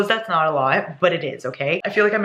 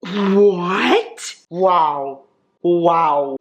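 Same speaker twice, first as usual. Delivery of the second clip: real shock at the book; stunned disbelief; 4 dB louder, much slower than usual. Real, not acted. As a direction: gasp it out, floored by the page. Stunned by a spicy scene.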